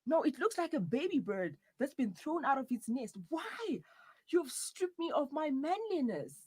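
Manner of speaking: high, loud, dramatic protest; outraged wailing. Acted. Partner protests being shaved, in high outraged wails. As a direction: wail as if robbed of your manhood.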